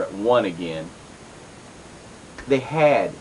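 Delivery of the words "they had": had pressed with weight, falling; stress on had. Shown as a demonstration. Host stresses had to the listeners while explaining the comic reboot. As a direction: lean hard on the word had. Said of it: "emphasis on the word had".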